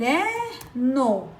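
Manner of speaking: "caderno" said slowly, one syllable at a time; syllables split. Shown as a demonstration. Teacher showing children the syllables, slow and split. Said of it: "Ó, vejam só, tem três sílabas".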